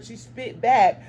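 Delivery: plain reading voice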